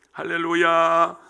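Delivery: ordinary delivery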